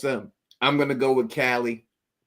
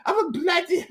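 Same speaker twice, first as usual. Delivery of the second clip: mock British accent; 5 dB louder than usual. Mimicking British reality show contestants, high hammed-up British accent. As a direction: ham up a Brit saying bloody.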